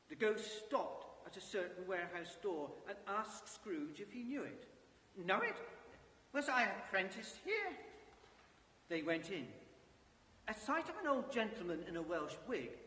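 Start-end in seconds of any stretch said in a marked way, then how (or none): 5.2-7.8 surprised. Acted. Scrooge recognizes old workplace.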